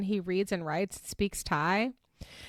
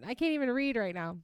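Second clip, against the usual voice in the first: ordinary talk, nothing marked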